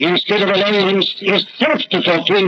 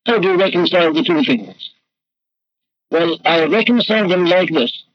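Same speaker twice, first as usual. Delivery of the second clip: ordinary talk, nothing marked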